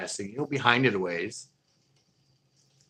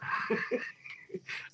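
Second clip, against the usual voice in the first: laughing; 6 dB quieter than usual. Real, not acted. Laughing at the fight.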